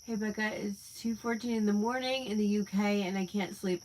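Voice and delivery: ordinary talk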